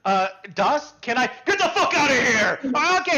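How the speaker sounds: put-on high, loud boyish voice with a laugh; a cheeky boy cracking up. Boy asks smith, laughing.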